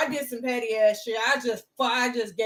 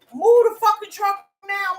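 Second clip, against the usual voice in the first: higher, louder mock yell at the driver; old man yelling; 7 semitones higher, 4 dB louder than usual. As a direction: bark it like an angry old neighbour.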